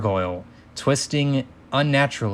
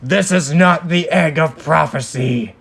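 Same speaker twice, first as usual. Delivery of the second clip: loud booming villain voice; 4 semitones higher, 5 dB louder than usual. A narrator's reading. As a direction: boom it down from the dark.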